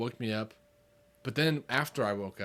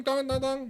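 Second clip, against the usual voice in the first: higher sung clang noises; imitated metal clang; 12 semitones higher than usual. Describing a strange noise, higher clanging imitation. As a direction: ring it out like metal on concrete. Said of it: "it goes dong".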